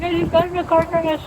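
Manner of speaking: high, tearful, shaken telling; crying. Retold or imitated. Telling her boyfriend about the crash, tearful and high. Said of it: "I was, like, crying".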